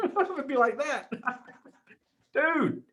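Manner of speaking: high laughter spilling over the words; laughing. Real, not acted. Host cracking up over his own joke about the winner, laughing. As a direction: laugh through it, amused.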